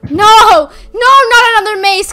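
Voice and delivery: loud, high frustrated no; exasperated shout. Real, not acted. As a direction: groan out loud in dismay. Dismay at another maze.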